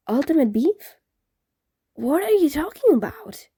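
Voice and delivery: put-on baffled teen ghost; confused ghost.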